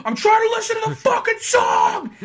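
screamed high and loud, a hammed-up hockey goon; yelling over the anthem, hammed-up; mock furious yell. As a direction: bellow it like an outraged player. Retold player yelling at ref.

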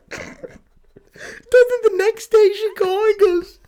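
high squeaky voice through laughter; real laughing fit. Real, not acted. Telling co-host about the caller's return, helpless laughter. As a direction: struggle to get the words out laughing. Cracking up while telling own prank.

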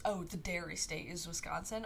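ordinary talk, nothing marked

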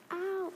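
short high yelp of pain; sudden high "Ow!"; cry of pain. Acted. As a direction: yelp as the knife cuts. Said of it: "I screamed".

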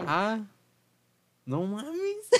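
muy aguda, sorprendida; sorpresa chillona. Retold or imitated. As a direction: chilla de asombro. Reacción asustada imitada. (sorpresa aguda)

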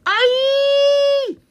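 long, piercing high monster shriek; creature screech. A narrator's reading. Cave monster startles the boys with a loud screech. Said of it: "a loud screech came from inside".

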